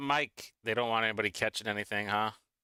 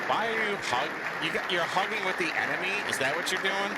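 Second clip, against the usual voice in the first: higher, put-on teasing voice; mock scolding; 6 semitones higher than usual. Streamer teasing a lawmaker hugging a rival, higher mock scold. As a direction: needle him like a playground tattletale.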